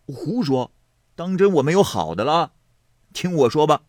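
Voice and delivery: high, forceful objection; indignant retort. A narrator's reading. Xue Pan refusing the girl's help, indignant. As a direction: bristle and insist on your turn. Rejects offer to speak for him.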